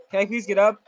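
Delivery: ordinary talk, nothing marked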